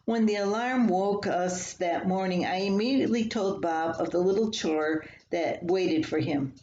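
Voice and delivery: plain reading voice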